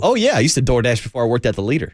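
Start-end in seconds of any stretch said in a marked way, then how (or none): 0.0-0.3 excited. Real, not acted. Eager high reply.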